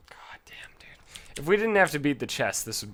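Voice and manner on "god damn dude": whispered curse. Real, not acted. Muttering at the game.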